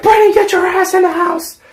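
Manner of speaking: very high, loud put-on mom shout; mock mom yell. Mom ordering her son inside, very high mock mom yell. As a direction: yell like a furious caricatured mother. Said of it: "then she's like".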